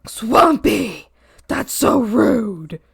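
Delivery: scolding mom, slow and drawn out; imitating mom. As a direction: tut at her like a shocked mother. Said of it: "she's like".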